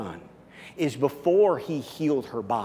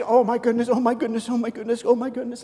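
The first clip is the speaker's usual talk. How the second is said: overwhelmed gasp; 9 semitones higher, 3 dB louder, faster than usual. Acted. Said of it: "this woman is just".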